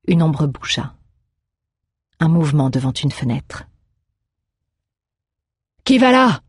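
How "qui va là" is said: high, loud sentry's challenge; startled "who goes there" shout; exclaimed challenge. A narrator's reading. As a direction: shout out at an unknown figure in the dark. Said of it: "s'exclama Erwan".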